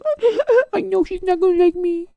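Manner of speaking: very high, hammed-up pitiful voice; mock whining. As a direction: imitate a sorry little whiner. Mimicking the whiny character conceding.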